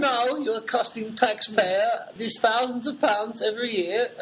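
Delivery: high, slow nagging voice; scolding boots. Pretend. Boots scolding an elderly mother, high slow nagging voice. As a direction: nag like a snooty official. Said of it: "boots scolding the mother".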